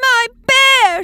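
high, loud tearful wail; sobbing child. A narrator's reading. Young Polly cries for her lost bear, sobbing. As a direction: cry out like a small girl in tears. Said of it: "she sobbed".

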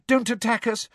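put-on high, urgent pleading girl; Hermione, breathless. A narrator's reading. Girl begging centaurs not to attack, breathless. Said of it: "said Hermione breathlessly".

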